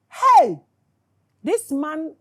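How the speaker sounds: loud, high cry of outrage; outraged shout. Real, not acted. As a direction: yell it out in disbelief. Outraged exclamation at the audience.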